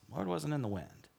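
a quiet, pointed repeat; hushed emphasis. Shown as a demonstration. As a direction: drop the volume and lean on each word. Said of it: "you gotta pay attention to this".

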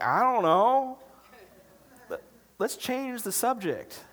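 high, loud, comic dodge; panicked evasion. Acted. Dodging a friend's hard moral question.

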